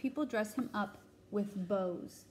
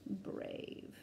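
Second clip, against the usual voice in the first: whispered; 9 dB quieter than usual. Shown as a demonstration. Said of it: "Max, the brave".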